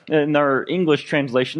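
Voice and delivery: usual speaking voice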